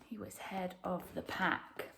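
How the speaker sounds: soft whispered line; whispered storytelling. A narrator's reading. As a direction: hush it like a secret. Hushed narration about lion.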